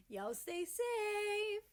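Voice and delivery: high, drawn-out teasing farewell; slow tease. A narrator's reading. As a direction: stretch it out slowly to tease him. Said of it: "Canada teases".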